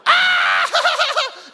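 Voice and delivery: very high, very loud pained scream; agonised scream. Retold or imitated. Preacher acting out his brother's scream as the tongue rips, very high and loud. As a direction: shriek as the tongue tears.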